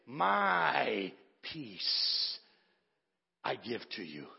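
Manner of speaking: My drawn out soft and low; savouring the verse softly; tender emphasis. Acted. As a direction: linger on My, almost hushed. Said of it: "I love the way it reads".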